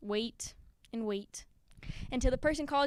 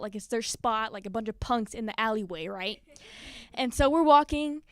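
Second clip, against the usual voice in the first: ordinary talk, nothing marked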